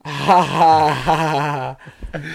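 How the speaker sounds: loud, drawn-out mock laughing; sarcastic fake laugh. Answering a friend's teasing question, heavily sarcastic. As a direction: pretend it's hilarious.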